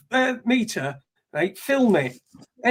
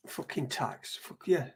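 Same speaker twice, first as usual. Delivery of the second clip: whispered curse; quiet, voiceless grumble; muttered under breath; 6 semitones lower, 11 dB quieter, slower than usual. Real, not acted. Muttered aside.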